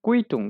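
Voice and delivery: "কুইটুং" said kvi-tung, German style; teacher's model of German "কুইটুং"; German word pronunciation. Shown as a demonstration. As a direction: demonstrate "কুইটুং" in two clear syllables. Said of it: "প্রনাউন্সিয়েশনটা হবে কুইটুং".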